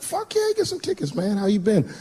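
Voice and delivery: put-on light up like hearing from an old friend; excited greeting to an old friend. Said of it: "I was like".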